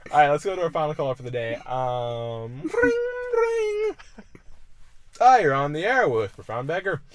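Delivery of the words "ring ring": high sung ring ring; mock phone ring. Host faking the final caller's ring, high. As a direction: chirp it like a phone.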